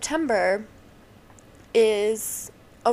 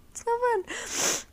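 much higher, slow whining complaint; high whiny whine; 13 semitones higher, slower than usual. Real, not acted. Repeated complaint.

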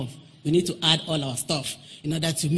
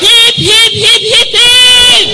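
hooray cheer; 16 dB louder, much slower than usual. Real, not acted. Leading a cheer.

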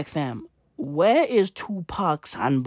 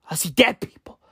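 whisper; 3 semitones higher than usual. Pretend. Quoting a spooky film line.

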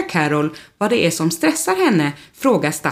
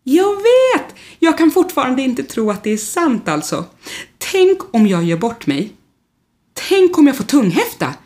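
high, louder, anxious gushing; nervous excitement; 7 semitones higher, 3 dB louder than usual. Acted. Rodolfo fretting before the show, high and nervous. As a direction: panic a little, higher with each worry. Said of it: "ser orolig ut".

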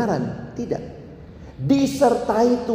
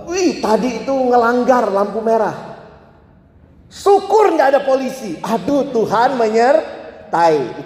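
high, animated exclamation of relief; pitched-up mock thankfulness, stretched at the end; 6 semitones higher, slower than usual. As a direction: act out gleefully getting away with it. Acting relieved red-light runner.

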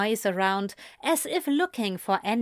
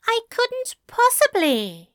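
higher-pitched, scandalised angel; flustered refusal; 4 dB louder than usual. Acted. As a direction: refuse primly, voice lifted.

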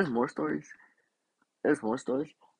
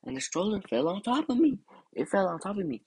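exclaiming; 9 semitones higher than usual. Real, not acted. Dramatising the stroller's fall.

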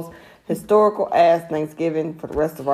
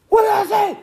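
mock deep stern dad; 11 semitones higher than usual. Mother mocks the baby daddy scolding in his bass voice. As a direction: bark it like the dad, laughing. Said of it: "Jeff would be like".

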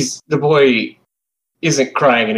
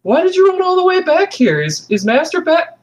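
put-on very high child's voice calling out; young boy searching for his pet; boy calling his cat. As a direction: call the cat like a worried little boy. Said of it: "So you hear this boy go".

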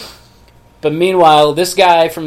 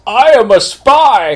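loud, slow, higher cry; shouted confession; 6 semitones higher, 7 dB louder, much slower than usual. Retold or imitated. Francois blurting out his confession to his captors, a loud slow cry. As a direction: blurt it out dramatically. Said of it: "Francois goes".

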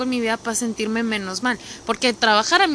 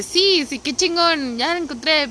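higher, brighter joyful cry; excited; 6 semitones higher than usual. Retold or imitated. Speaker finding her gym clothes in a suitcase, excited higher cry. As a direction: relive finding the lost clothes happily. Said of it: "porque dije".

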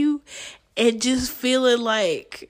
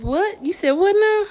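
higher, sharp retold comeback; incredulous challenge; 10 semitones higher than usual. Retelling her comeback to people who teased her, incredulous challenge. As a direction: throw back a challenging what.

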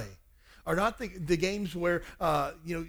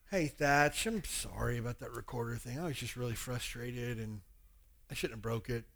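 sincere apology; 6 semitones lower, 5 dB quieter than usual. Retold or imitated. Father apologizes to son.

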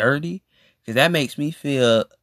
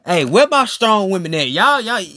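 calling out; 5 semitones higher, 5 dB louder than usual. Real, not acted. Calling out to strong women.

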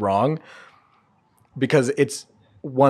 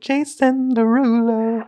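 his signature tag; 9 semitones higher, slower than usual. Retold or imitated. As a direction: croon his name like his song intro. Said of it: "even just quietly in the background".

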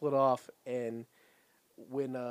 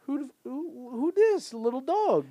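put-on baby talk; 12 semitones higher, 4 dB louder, slower than usual. Revenger coos at the puppy.

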